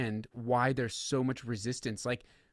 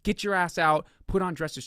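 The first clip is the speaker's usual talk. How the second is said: higher, louder mock furious rant; retold judge's rant; 5 semitones higher, 5 dB louder than usual. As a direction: tear into her like an outraged judge. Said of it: "And he was like".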